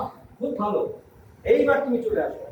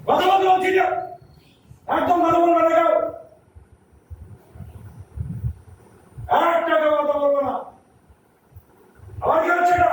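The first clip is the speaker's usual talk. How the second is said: higher, louder furious scolding of the class; angry scolding; 6 semitones higher, 3 dB louder than usual. Shown as a demonstration. Teacher demonstrating anger to the children, loud angry scolding. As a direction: scold them hard to show what anger sounds like. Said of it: "এটা রেগে যাওয়া".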